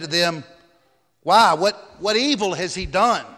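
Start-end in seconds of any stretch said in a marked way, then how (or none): 1.2-3.3 protesting question. A narrator's reading. Pilate challenging the hostile crowd.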